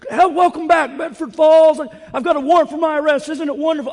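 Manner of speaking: high, loud, overjoyed Stewart-style cry, rushing; Jimmy Stewart impression. Pastor imitating the film hero's joyful homecoming cry, high and loud. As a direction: burst out like a man given his life back. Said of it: "Jimmy at the end of It's a Wonderful Life".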